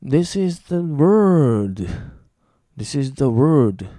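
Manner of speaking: slow, emphatic model sentence ending on a drawn-out "word"; clear pronunciation demo. Shown as a demonstration. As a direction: stretch the last word with a curled r and no l. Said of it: "発音の違いをしっかり耳で覚えて".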